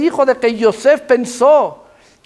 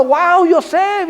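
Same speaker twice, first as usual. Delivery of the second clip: higher, drawn-out mock wow; imitated fan admiration; 6 semitones higher, much slower than usual. Preacher voices Egyptians adoring the celebrity Yosef, mock-admiring.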